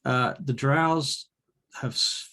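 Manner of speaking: ordinary talk, nothing marked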